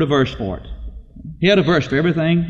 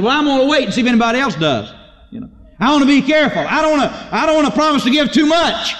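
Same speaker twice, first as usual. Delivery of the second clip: high-pitched, hesitant excuse-making; mock reluctance; 8 semitones higher than usual. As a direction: mimic a cautious giver hedging. Mimics a reluctant giver.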